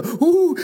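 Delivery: high, loud whoop of happiness; excited "ooh". Shown as a demonstration. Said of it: "I have these things where I'm either".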